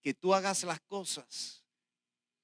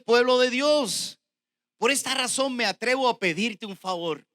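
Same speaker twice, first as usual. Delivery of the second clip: loud, high, ringing verse reading; preached scripture reading; 7 semitones higher, 8 dB louder than usual. Real, not acted. Preacher reading Paul's letter to Philemon, proclaimed loudly. Reads the verse at full force.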